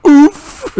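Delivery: high squeaky oof; sudden pained oof; imitated grunt of pain. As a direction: mimic the hit. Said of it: "smacked him across the balls and goes".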